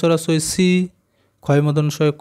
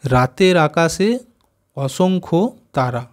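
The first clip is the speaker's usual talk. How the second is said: slow reading aloud; much slower than usual. Shown as a demonstration. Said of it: "এটা আমরা পড়বো".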